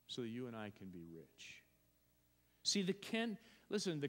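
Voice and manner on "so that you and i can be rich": softer, fast, thrown-away repeat of "So that you and I can be rich"; quiet quick echo. Real, not acted. Preacher echoing his point under his breath, quick and soft. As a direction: let it drop to a soft rush.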